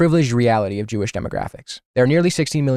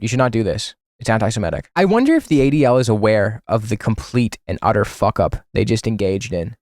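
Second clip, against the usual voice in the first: normal talking voice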